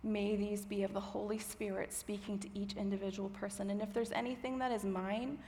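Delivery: soft, unhurried praying voice; prayer. Real, not acted. Preacher praying to God before the message, soft and measured. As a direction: pray quietly, with pauses between phrases. I'm gonna pray.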